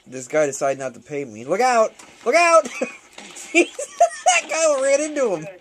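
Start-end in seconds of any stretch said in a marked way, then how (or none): 1.5-2.6 sudden rising yell of look out; real warning shout. Real, not acted. Warning during gunfight. 2.6-5.5 words broken by real high laughter; laughing. Real, not acted. Laughing at game collision.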